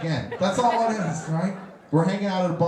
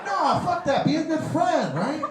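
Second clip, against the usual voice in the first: normal talking voice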